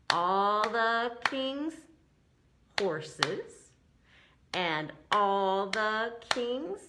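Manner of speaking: very slow, each syllable clapped apart; clapping out syllables. Shown as a demonstration. Teacher clapping syllables of the rhyme, very slow with pauses. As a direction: beat out every syllable with pauses. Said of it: "doing some clapping with this one to do the syllables".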